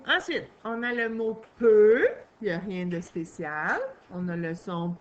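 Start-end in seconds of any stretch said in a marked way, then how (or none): 1.6-2.1 peu said much louder, clearly set apart; dictation word stressed. Shown as a demonstration. Teacher calling out a dictation word to pupils, loud and clear.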